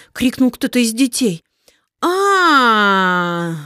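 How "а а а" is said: long distant witch's wail; a huge voice bellowing in rage; thunderous roar. Acted. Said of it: "раздался страшный громовой голос Неуберинды".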